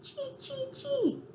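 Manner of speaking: high squeaky chee chirps; cricket chirp. Retold or imitated. Cricket imitates how people hear its chirp. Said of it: "Some people say a cricket goes".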